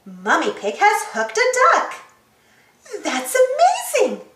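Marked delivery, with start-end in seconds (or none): 3.0-4.3 high, delighted amazed exclamation; amazed cry. Acted. Miss Rabbit amazed at Mummy Pig's catch, in a high amazed cry. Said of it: "cries Miss Rabbit".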